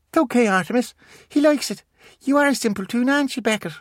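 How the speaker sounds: put-on high small-boy voice; a young boy asking eagerly; child voice. Miles asks Artemis, in a high child voice.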